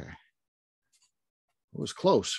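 very slow, stretched "It was close" with a rise on "close"; savoring the suspense of a close race; drawn out. Real, not acted. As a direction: let "It was close" hang, slowly. Drawn-out musing before the result.

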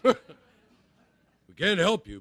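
slow, laughing church voice; laughing mock quote. Mocking how his church sent Floyd off to AA, laughing. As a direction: laugh through the church's brush-off.

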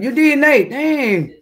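high and loud, delighted; light up at the news; excited surprise. Real, not acted. Streamer reacts to viewer Nate's news, thrilled. Greeting a viewer who joined.

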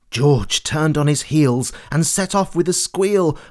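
ordinary delivery

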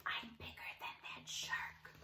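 whispered in fear. Acted. Squid whispering so the shark won't hear. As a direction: barely breathe the words, the shark is close.

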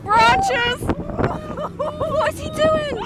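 high thrilled shrieks over the engine; excited shrieking. Real, not acted. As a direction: squeal with fear and delight. Thrilling skidoo ride, shrieking.

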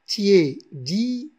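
"tiédissent" slowly split tié-di-sse; slow, syllable-by-syllable "tiédissent", E voiced; counted final E. Shown as a demonstration. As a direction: say "tiédissent" with a full "-seu" syllable at the end. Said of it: "On a dit ce".

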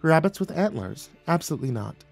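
understated, decisive dismissal; firm. A narrator's reading. Nymph denying what the guard saw, firmly. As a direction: rule it out calmly. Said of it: "said firmly".

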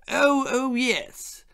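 high, trilling lady's voice; society lady resuming. A narrator's reading. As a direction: pick the thread up, pitched high. Lady de Trop restarts chatter.

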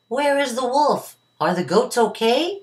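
anxious villagers out of breath; breathless gasp. A narrator's reading. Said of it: "the villagers gasped".

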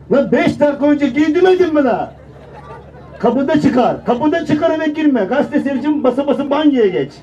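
high, loud hollering scold; mock furious mother. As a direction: yell like a mother at a muddy kid. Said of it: "tepki şu". (bağırıp azarlayan anne taklidi)

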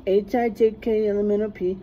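plain reading voice

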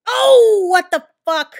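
high, very loud cry; loud gamer frustration; exasperated outburst. Real, not acted. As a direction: explode at the missed shot. Furious at missed shot.

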